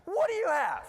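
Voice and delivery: high, loud accusing yell; imitated teacher's demand. Teacher with hand under girl's chin, high mock shout. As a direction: demand it like a teacher cornering a child. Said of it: "The teacher's right up against her".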